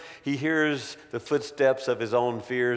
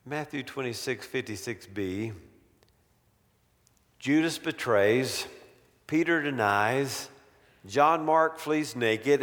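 normal talking voice

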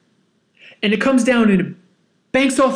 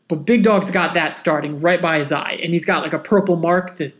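usual speaking voice

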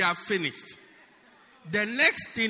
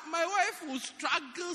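ordinary talk, nothing marked

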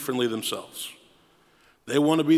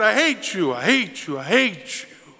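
echo; 3 semitones higher than usual. A narrator's reading. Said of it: "And he heard".

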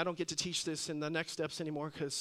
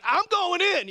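high, loud mock outburst; insistent wife; 11 semitones higher, 9 dB louder than usual. Wife insisting to hospital staff she's going in, forceful. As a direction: imitate a wife who won't be refused.

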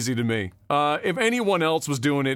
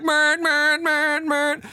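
long, high monotone horn blare; drawn-out mock honking on one held note; imitated truck horn; 9 semitones higher, much slower than usual. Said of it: "his flags and his fucking horn".

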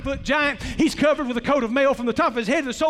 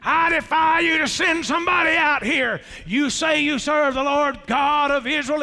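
Goliath's taunt. Acted. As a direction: shout it across the valley to the army. Goliath taunting Israel's army.

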